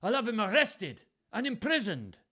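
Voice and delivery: high, loud Welsh outrage; snarling threat. A narrator's reading. Father threatens to jail his son, Welsh snarl. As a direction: threaten in hammed-up stage Welsh. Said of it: "snarled Gloucester".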